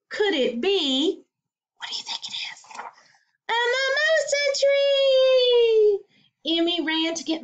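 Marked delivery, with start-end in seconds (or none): 1.8-2.6 secret whisper to listeners; whispered question. A narrator's reading. Reader teasing young listeners, hushed whisper. 3.5-6.0 high, loud, slow delighted cry; joyful reveal. A narrator's reading. Reader revealing the mimosa tree, loud slow delight.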